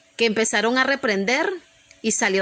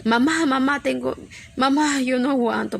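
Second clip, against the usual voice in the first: lightly voiced pleading daughter, pitched up; mock tearful plea, a little higher; imitated whining child; 3 semitones higher than usual. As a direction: imitate a scared child calling for mom. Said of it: "la Chayito es bien llorona".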